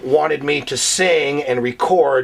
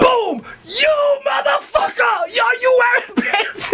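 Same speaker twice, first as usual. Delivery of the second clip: very high, stretched-out enraged screech; furious yelling; slower than usual. Retold or imitated. Storyteller replaying host's rant over wedding PA, furious yelling. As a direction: replay the tantrum in a high, hammed-up shout.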